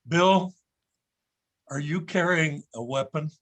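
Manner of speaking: ordinary delivery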